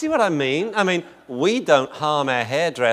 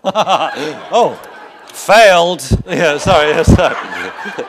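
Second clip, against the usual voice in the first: laughing; 4 semitones higher, 7 dB louder, much slower than usual. Real, not acted. Laughing at failed test joke.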